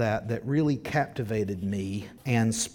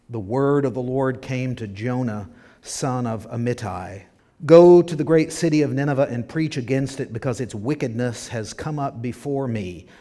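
louder, proclaiming scripture reading; 6 dB louder than usual. Acted. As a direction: proclaim the passage to the congregation. Reads scripture aloud.

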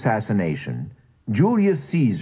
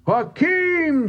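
much higher roar of a name. A narrator's reading. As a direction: roar it at the shut door. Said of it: "Joseph roared".

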